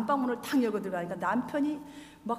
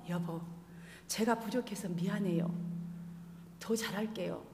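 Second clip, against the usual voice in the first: soft apology; 4 semitones lower, 7 dB quieter than usual. Retold or imitated. Wife apologizes softly to husband.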